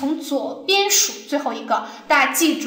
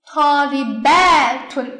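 Talibatun with BA held long and pushed loud; slow Talibatun, swelling on the long ba; stress on BA; 4 dB louder, much slower than usual. Shown as a demonstration. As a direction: lean hard on ba in Talibatun. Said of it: "所以重音就在它上面".

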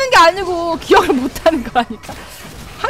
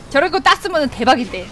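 normal talking voice

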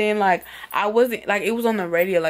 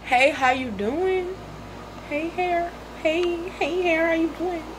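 higher, sweet greeting to her hair; cooing at hair; 8 semitones higher, 3 dB quieter than usual. Pretend. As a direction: coo hello to your hair like a friend.

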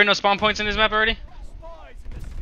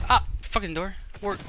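normal talking voice

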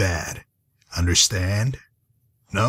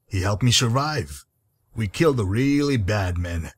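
ordinary delivery

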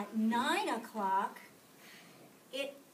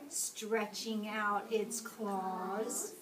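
ordinary delivery

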